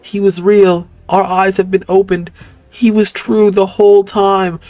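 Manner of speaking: a hint of a prim woman in awe; Patricia persona.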